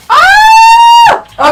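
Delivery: a piercing, very high, loud scream of joy; thrilled scream. Real, not acted. Streamer screams at seeing her rank jump, ecstatic. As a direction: scream with real disbelief and delight.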